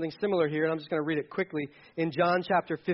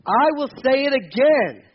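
loud, higher, slow proclamation; proclaimed shout; 7 semitones higher, 7 dB louder, much slower than usual. Retold or imitated. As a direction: boom it out like a herald. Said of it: "which is highlighted".

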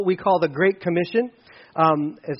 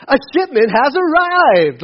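higher, chirpy, cheerful device voice; imitating Alexa; 7 semitones higher, 6 dB louder, slower than usual. Pastor mimicking the home assistant, higher and chirpy. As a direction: mimic a perky assistant announcing a package. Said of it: "she was all happy and excited".

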